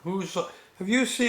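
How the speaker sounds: ordinary delivery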